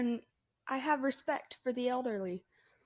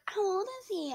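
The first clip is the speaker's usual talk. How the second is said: lightly voiced old lady; 10 semitones higher than usual. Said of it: "she's like".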